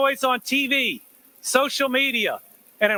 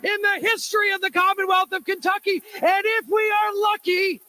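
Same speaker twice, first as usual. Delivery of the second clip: announcer introduction; 6 semitones higher than usual. Real, not acted. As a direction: hype the crowd up for the governor. Rally host introduces governor.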